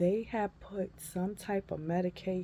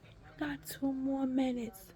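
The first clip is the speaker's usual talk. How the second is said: slow, higher-pitched imitation of the nurse; lightly voiced nurse; 7 semitones higher than usual. Nurse tells patient time remaining, slow lightly voiced. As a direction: draw it out lightly, imitating staff. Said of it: "She was like".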